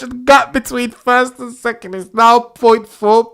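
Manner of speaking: high, loud frantic cries; panicked yelling. Real, not acted. Max fan yelling at George to leave Max alone. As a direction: shriek at the screen in alarm.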